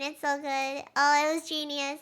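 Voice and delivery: ordinary delivery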